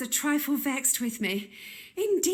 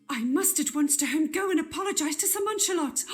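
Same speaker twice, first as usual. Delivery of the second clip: ordinary talk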